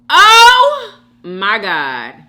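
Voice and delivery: loud, slow, drawn-out cry; exasperated exclamation. Real, not acted. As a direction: stretch it out, utterly fed up. Exasperated by pet peeve.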